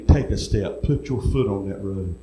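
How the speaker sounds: lightly voiced low command; the Lord. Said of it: "And he said to me".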